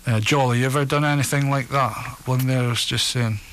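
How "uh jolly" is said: normal talking voice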